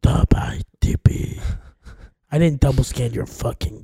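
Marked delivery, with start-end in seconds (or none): 0.0-1.4 low threatening whisper; menacing whisper. Acted. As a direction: whisper it like a killer. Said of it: "it sounds like you're gonna kill me".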